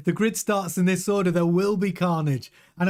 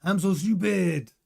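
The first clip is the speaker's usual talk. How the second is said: slow, put-on French accent; Leclerc's French accent; slower than usual. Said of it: "That's my French accent".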